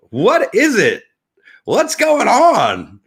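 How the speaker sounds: high, loud exasperation; exasperated outburst. Real, not acted. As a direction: cry out in bewilderment, stretching the words. Mock exasperated outburst at news.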